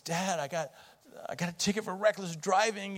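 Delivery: put-on a touch of a shaken boy confessing; upset son. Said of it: "he's very upset".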